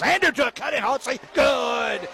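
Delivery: rising, shouted play call; excited call. Real, not acted. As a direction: call the basket with a burst of excitement. Basket on a backdoor cut.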